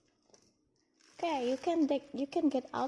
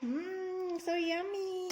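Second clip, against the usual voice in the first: munching animal voice; 6 semitones higher, 3 dB quieter than usual. Pretend. Toy animal eating.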